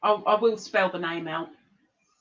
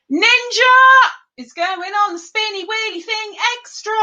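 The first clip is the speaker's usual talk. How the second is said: excited; 11 semitones higher, 7 dB louder than usual. Real, not acted. Excited game host announcing.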